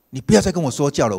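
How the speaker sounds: loud, sharp mock-angry warning; angry man telling the pastor to stop preaching; angry retort. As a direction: snap it at the pastor. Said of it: "气冲冲的跟我讲说".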